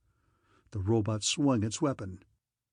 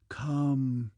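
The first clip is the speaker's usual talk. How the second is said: seductive whisper. A narrator's reading. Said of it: "begged that seductive whisper".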